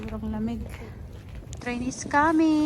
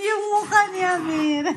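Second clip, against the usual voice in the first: higher, laugh-choked remark; 7 semitones higher, 3 dB louder than usual. Real, not acted. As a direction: laugh through the words. Laughing at dog's face.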